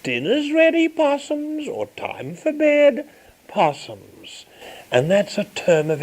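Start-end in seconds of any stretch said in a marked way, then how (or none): 0.0-1.7 high sing-song call to dinner; mother sings out dinner's ready to her kids. Retold or imitated. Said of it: "She'd say". 2.0-4.5 put-on mother calls her children to bed, rising then falling; mother calling kids. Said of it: "She'd say".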